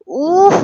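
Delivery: a sharp, loud, high groan of pain. A narrator's reading. Husband covering his cut lip, sharp loud groan of pain. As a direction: yelp through the hand over his mouth.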